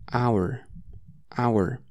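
ordinary talk, nothing marked

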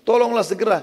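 disguised voice. Retold or imitated. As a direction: fake a frightened call for help, pitched well up. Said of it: "Saya ubah lagi suara saya". (suara yang disamarkan, jauh lebih tinggi, panik)

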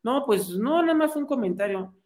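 high, meek little excuse; sheepish backing down. Retold or imitated. Friend retreats before the skeptic in a high timid voice. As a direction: shrink away from the question, voice up high.

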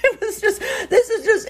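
real laughter through the words, high-pitched; laughing. Real, not acted. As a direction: laugh helplessly while trying to talk. Laughing at her own lake mishap.

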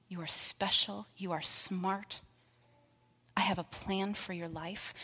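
soft, hushed words of love; gentle whisper. Retold or imitated. God speaking love over his child, soft and hushed. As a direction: whisper it like a loving father. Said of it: "he will probably whisper things like".

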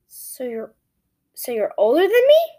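usual speaking voice

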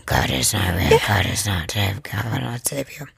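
deep, gurgling possessed voice as if underwater. Acted. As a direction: speak like you're drowning, low and eerie. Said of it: "Do it in your underwater voice".

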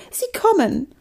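very high cry of warning; high-pitched panicky alert; alarmed call. Acted. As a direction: warn them urgently, pitched high. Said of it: "rief Mathilda".

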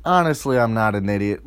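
ordinary talk

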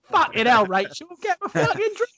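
Grant Mitchell impression. Co-host mocks him demanding a drink, hammed-up Cockney hard man. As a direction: ham up a bad EastEnders geezer voice in Cockney. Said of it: "a terrible Grant Mitchell impersonation".

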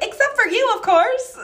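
high, loud, hurried backpedal; awkward save. Retold or imitated. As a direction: cover the blunder fast. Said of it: "and I was like".

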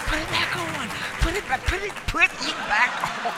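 high, insistent begging voice; mock panicked wife pleading; pleading spouse. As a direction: beg them to cover up again.

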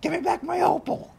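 high squeaky mock voice; mock teacher. Cohost mocking the teacher, high squeaky demand. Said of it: "Miss Howard is like".

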